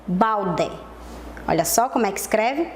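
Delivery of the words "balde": "balde" low, with a clear final DE; spelling pronunciation of "balde", hard de; said as written. Shown as a demonstration. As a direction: say "balde" as bal-DE, full E instead of I. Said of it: "mas é o E".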